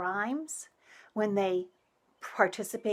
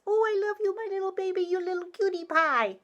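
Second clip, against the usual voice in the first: put-on much higher sing-song baby talk; parentese; 10 semitones higher, faster than usual. Shown as a demonstration. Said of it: "It's a little higher voice. There's very much sing-song to it".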